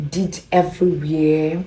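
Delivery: usual speaking voice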